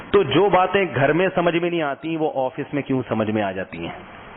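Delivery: ordinary talk, nothing marked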